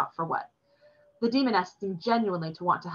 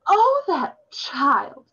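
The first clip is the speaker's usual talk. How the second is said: much higher, louder, drawn-out oh; exasperated cry; 11 semitones higher, 7 dB louder, much slower than usual. A narrator's reading. Demoness seeing her niece fall through the door, exasperated cry. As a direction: throw up your hands at a clumsy child. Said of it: "slapped her hand on her forehead".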